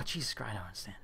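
barely audible disbelief; baffled murmur. Real, not acted. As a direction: mutter it to yourself, defeated. Repeats in disbelief.

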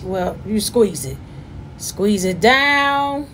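ordinary talk

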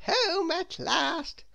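high, very slow character voice; Little Miss Scatterbrain. A narrator's reading. Little Miss Scatterbrain arrives home, in high drawn-out voice. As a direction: draw it out in a high voice. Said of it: "said little Miss Scatterbrain".

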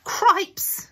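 high startled cry; surprised exclamation. A narrator's reading. Mr Bucket reacting to the date, high startled cry. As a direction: blurt it out in surprise. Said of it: "said Mister Bucket".

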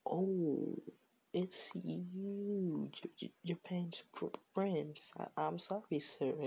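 mildly flustered, stumbling apology; flustered recognition. Acted. Student recognizes Japan's prince and apologizes, mildly flustered.

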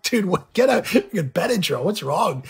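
startled, pushing him to act fast; alarmed outburst. Retold or imitated. Reacting to friend's sudden allergy, alarmed and high. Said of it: "I'm like".

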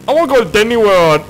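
loud, high mock kid voice; pretend excited child. [name] answering what he'll do grown up, pretend excited child. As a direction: yell it like a hammed-up eager little kid.

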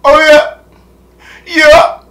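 high, loud put-on exclamations; short high-pitched bursts, playing around; playful mock hype. YouTuber clowning before his intro, mock hype.

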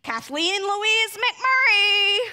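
loud, slow, full-name telling-off; parent calling full name. Retold or imitated. A parent summoning a child, loud scolding. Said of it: "like a parent who calls you by your entire name".

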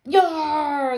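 long, loud pirate "Yar"; pirate shout. A narrator's reading. Ghost pirates all together, loud drawn-out shout. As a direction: holler like a pirate crew. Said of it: "the pirates all shouted".

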